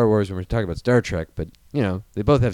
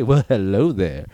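slow, low, smooth ladies'-man purr; Billy D impression; 3 semitones lower, slower than usual. Lando greeting someone with slow, smooth Billy D charm. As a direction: drawl it out like a suave charmer. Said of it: "I can't wait for him to say".

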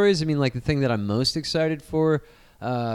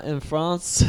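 put-on French accent; 4 dB quieter than usual. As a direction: fake French nasal accent. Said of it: "Wait, that's not French".

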